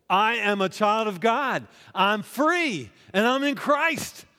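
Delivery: high, loud call-and-response preaching; full-force preaching. Acted. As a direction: rouse the crowd to shout back. Preached affirmation climax.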